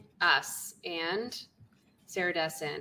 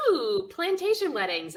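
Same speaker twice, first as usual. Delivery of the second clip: mock delight; 8 semitones higher, 3 dB louder than usual. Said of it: "I'm like".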